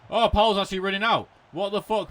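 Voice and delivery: high, loud, fast shocked blurt; alarmed surprise. Real, not acted. Gamer seeing his keeper rush out, loud alarmed blurt. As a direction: caught off guard by the keeper.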